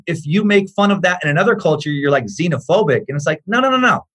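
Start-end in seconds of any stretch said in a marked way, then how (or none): none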